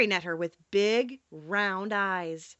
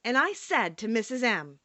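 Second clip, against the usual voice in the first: usual speaking voice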